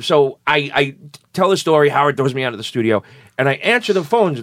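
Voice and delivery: ordinary talk, nothing marked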